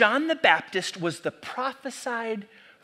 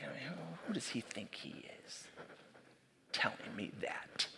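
whispered, much softer grumble; frustrated whisper; 6 semitones lower, 18 dB quieter than usual. Retold or imitated. Churchgoer leaving frustrated, whispering a complaint. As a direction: mutter it under your breath on the way out.

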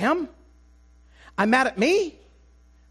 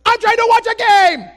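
frustrated shout; 11 semitones higher, 8 dB louder than usual. Retold or imitated. Shouting in frustration at a chatty teammate during the match. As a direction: bark it out at the guy who won't stop talking. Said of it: "I just said".

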